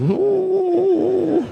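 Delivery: high whining "ooh" of a sad dog; pitiful dog whimper. Retold or imitated. Imitating the sad dog in the charity ad whimpering. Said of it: "This is".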